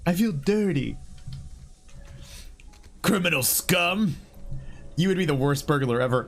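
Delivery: ordinary delivery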